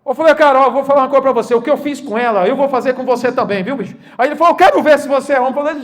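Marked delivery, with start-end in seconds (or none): 0.4-4.0 louder, fast retort; bold challenge. Retold or imitated. Pastor answering the angry manager, loud and fast. 4.5-5.5 louder, higher threat; angry challenge. Retold or imitated. Manager challenging the pastor, loud and higher.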